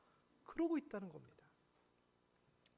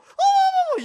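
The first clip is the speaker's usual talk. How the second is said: high, loud startled shriek; mock shriek; 19 dB louder, slower than usual. Wife shrieks at the dog again, hammed-up fright. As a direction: overplay the jumpy wife.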